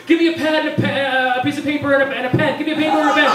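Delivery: high, loud, hurried order to the technician; urgent demand. Retold or imitated. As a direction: shout the demand up high, rushing. Dentist demanding pad and pen.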